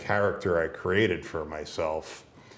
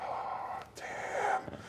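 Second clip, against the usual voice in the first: hushed, breath-only whisper; whispered dread; 10 dB quieter than usual. Retold or imitated. Son turning round to see his dad, whispered dread. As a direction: whisper it as if just caught. Retells dread at being caught.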